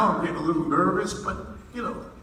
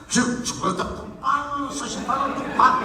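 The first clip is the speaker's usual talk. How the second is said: mock French accent; 6 semitones higher, 4 dB louder than usual. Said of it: "Pardon my French accent".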